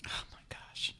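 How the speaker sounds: breathless, stunned whisper; a real, hushed, stunned reaction; shocked whisper. Real, not acted. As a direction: gasp the words out in disbelief. Host shocked by the story.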